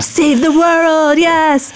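high, slow, hammed-up heroic declaration; mock grand savior. Mocking her own naive plan to rescue everyone. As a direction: stretch it out like a self-important rescuer. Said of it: "being like".

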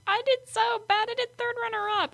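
put-on high whining kid voice; whiny. Girl whining to mom about placing third, whiny. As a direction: whine like a disappointed kid.

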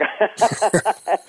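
bursting into high laughter; a quick high-pitched laugh. Real, not acted. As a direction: laugh at the joke. Laughing at the joke.

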